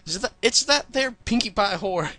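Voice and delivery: high, slow country outcry; nickered outcry. A narrator's reading. Applejack gallops toward the orchard, crying out in alarm. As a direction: cry out in hammed-up Southern alarm. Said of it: "as she nickers out".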